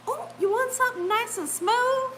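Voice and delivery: high, singsong coaxing question; sweet-talking stranger. Retold or imitated. Lady talking up the ride within earshot, high coaxing. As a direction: mimic an innocent-sounding sales pitch. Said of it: "That's how she was talking".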